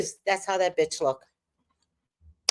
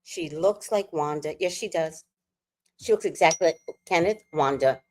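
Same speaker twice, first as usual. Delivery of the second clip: ordinary talk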